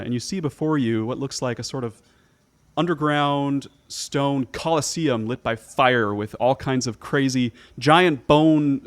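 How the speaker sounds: normal talking voice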